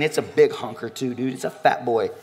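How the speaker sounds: ordinary talk, nothing marked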